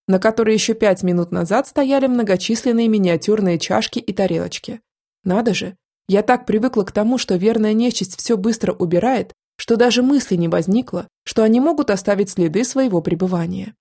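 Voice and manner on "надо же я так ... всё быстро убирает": ordinary delivery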